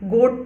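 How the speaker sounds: गोट opening on a G sound; hard G. Shown as a demonstration. Teacher reading goat for learners, hard-G pronunciation. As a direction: say गोट with G, not J. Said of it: "पढ़ेंगे".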